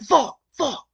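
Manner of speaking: high, loud yells of pain, fading slightly on the last; pained yelling. Retold or imitated. Boy with broken arm yelling on the mat, loud pained yells. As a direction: shout it out as the broken arm hits. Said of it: "like, yelling 'cause I broke my arm".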